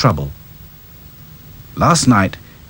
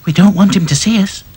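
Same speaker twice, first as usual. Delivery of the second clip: slightly higher doll voice; lightly voiced Lucy; 10 semitones higher than usual. Doll agreeing to hide, lightly voiced.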